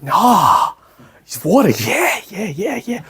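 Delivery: lightly voiced stoned mum, drawn-out yeahs; a hint of a dazed stoner caught out; mild mock stoner drifting along; stoned parent. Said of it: "you're like".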